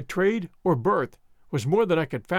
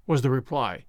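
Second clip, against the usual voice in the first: plain reading voice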